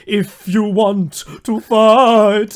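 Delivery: slow, loud, drawn-out dare; hammed-up villain challenge. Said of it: "and he's like".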